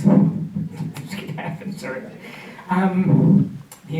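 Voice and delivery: real tears, laughing through them, slow; choked up, half laughing; fighting back real tears; teary. Real, not acted. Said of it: "I'm gonna get teary".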